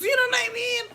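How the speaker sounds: high, cracking laugh-cry; squealing laugh. Real, not acted.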